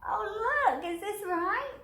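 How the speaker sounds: anxious checking; worried question. Acted. Fretting over rebuilt dog.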